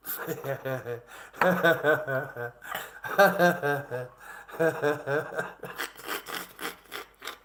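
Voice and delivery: long rattling fit of laughter; helpless drawn-out cackle. Real, not acted. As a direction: laugh it out in repeated bursts, trailing away. Amused at watching his tone.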